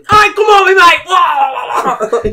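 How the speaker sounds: loud, very high Irish-style yell; screaming mock Irish. Gamer screaming at PUBG players, mock Irish scream. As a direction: scream it in a bad Irish accent. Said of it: "screaming at people acting like an Irish person".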